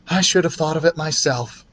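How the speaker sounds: a hint of a fretful mother; mother's voice. A narrator's reading. Wife fretting to her husband about the aspirin, lightly voiced mother.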